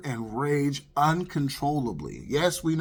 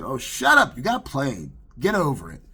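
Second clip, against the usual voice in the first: dismissive retort; 2 semitones higher, 6 dB louder than usual. Retold or imitated. Woman brushing him off on the phone, dismissive.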